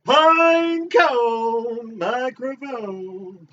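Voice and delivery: high, loud, very slow call; booming announcement. Acted. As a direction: stretch it out like a showman.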